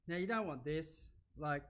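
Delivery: usual speaking voice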